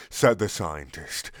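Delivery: ordinary talk, nothing marked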